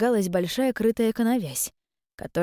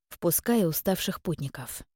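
ordinary delivery

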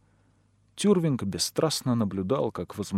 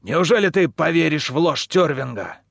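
angry shouting; 4 semitones higher, 6 dB louder than usual. A narrator's reading. Man shouts back at crowd.

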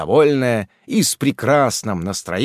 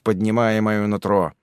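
ordinary delivery, nothing marked

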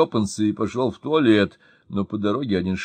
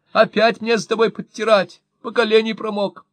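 high, loud scolding shout; shouting wife; 11 semitones higher, 5 dB louder than usual. A narrator's reading. Wife scolding her soaked husband, high loud shout. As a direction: scold him loudly for the mess. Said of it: "закричала вслед мужу Мощина".

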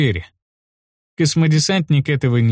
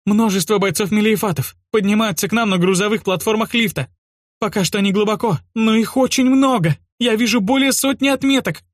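alarmed cartographer; 9 semitones higher than usual. A narrator's reading. Cartographer keeps raising the alarm.

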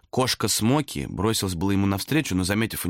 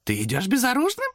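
high surprised demon question. A narrator's reading. Demon asks Thomas, surprised, why unarmed. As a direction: act amazed at his foolishness. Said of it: "удивлённо спросил демон".

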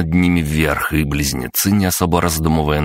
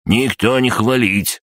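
slow, higher-pitched curt denial; stern; 7 semitones higher, slower than usual. A narrator's reading. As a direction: cut the boasting off sternly, word by word. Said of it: "сурово отрезал крабогном". (сурово, отрезая)

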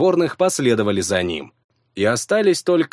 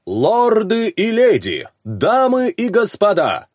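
herald's proclamation; 10 semitones higher, slower than usual. Acted. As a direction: proclaim it to the whole arena. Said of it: "вещал с трибуны глашатай".

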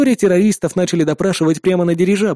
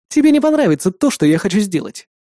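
plain reading voice

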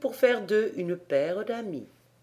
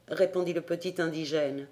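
ordinary delivery, nothing marked

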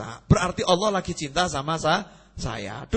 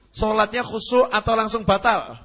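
usual speaking voice

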